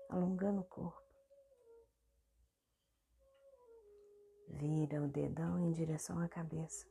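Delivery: soft meditation guide with long pauses; guided meditation. Real, not acted. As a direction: guide the body gently through each movement, leaving space between phrases. (meditação guiada, voz suave e pausada)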